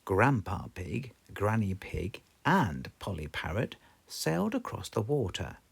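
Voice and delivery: usual speaking voice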